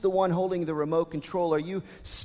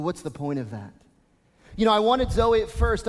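ordinary delivery

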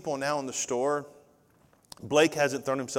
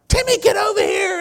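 high, loud yell across the store; parent yelling; 12 dB louder than usual. Retold or imitated. Parent in Walmart yelling for their kid, loud and high. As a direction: shout for a kid who wandered off.

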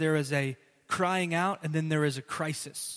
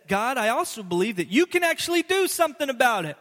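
higher, louder earnest appeal; louder, higher pleading confidence; hopeful plea; 6 semitones higher, 5 dB louder, faster than usual. Acted. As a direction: insist God can act. Voicing psalmist's trust.

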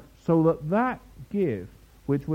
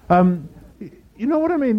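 ordinary talk, nothing marked

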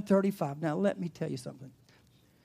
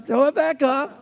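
higher, louder playful exclaim about throwing it back up; mock gross-out exclamation; 8 semitones higher, 10 dB louder than usual. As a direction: ham up the cow gag loudly. Cow regurgitating, mock.

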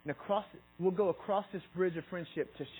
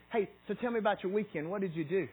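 bright, higher, curious ask; friendly question; 6 semitones higher, 4 dB louder than usual. Pretend. Greeting a neighbor, friendly and higher. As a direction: lift it up, genuinely interested. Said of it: "for example".

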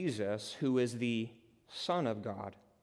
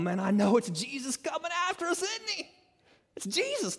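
much higher, squeaky mock-brave voice; mock brave disciple. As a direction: play the boastful disciple losing his nerve.

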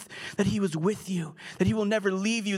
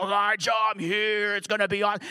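mock divine entrance. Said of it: "God just shows up and".

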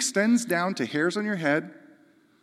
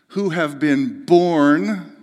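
slow weighty reading; 6 dB louder, much slower than usual. Acted. Scripture read with stress.

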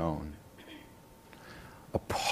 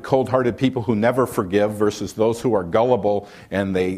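usual speaking voice

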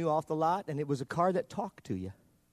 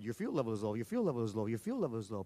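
harsher computer voice; 5 semitones lower, 6 dB quieter, faster than usual. Retold or imitated. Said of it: "the voice sounded harsher all the time".

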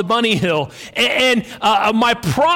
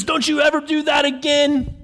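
higher-pitched acted telling-off; mock scolding; 5 semitones higher than usual. Manager shakes the parrot's cage, mock stern scolding. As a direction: scold like a fed-up store manager.

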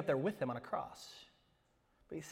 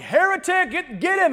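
high, loud hammed-up accusation; mock yell; 14 dB louder than usual. Imagined staff member denouncing the preacher, hammed-up yell. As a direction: yell it like rallying a mob in jest. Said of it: "he's not gonna yell".

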